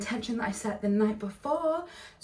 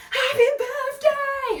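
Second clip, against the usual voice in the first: high, loud, drawn-out cheer; imitated crowd shout; 6 dB louder, slower than usual. Canal-side crowd answering birthday girl, high imitated shout. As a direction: holler it back as the crowd would.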